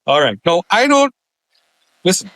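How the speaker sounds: ordinary delivery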